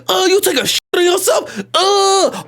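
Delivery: high, disgusted jeer; hammed-up mocking woman's voice; mocking nurse. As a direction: laugh in the kid's face with revulsion. Acted nurse mocking him.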